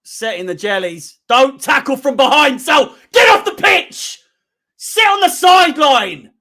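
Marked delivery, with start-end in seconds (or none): 1.3-6.3 very loud, high yell to get off the pitch; furious shout. Real, not acted. Fan furious at a player tackling from behind, shouting. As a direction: roar at him to leave the field.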